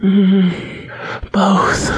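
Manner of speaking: high moaning hum into a breathy word; airy, overcome heroine; aroused breathlessness. A narrator's reading. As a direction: answer on a high, whimpering breath. Aroused heroine answers in his arms.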